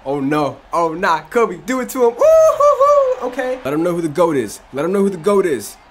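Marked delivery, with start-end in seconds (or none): 2.1-3.3 high, loud excited "ooh hoo hoo" hoot; hype hoot. Real, not acted. Cheering his player's dunk, a high loud hoot. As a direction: whoop like a crowd after a big dunk.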